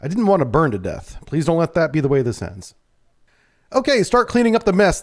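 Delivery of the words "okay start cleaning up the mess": raised-pitch command from an officer; giving an order. Acted. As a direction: order the crew to get to work. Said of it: "the X O ordered".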